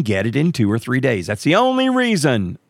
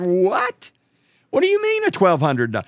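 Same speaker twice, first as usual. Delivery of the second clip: incredulous; 8 semitones higher than usual. Retold or imitated. Told about the limit.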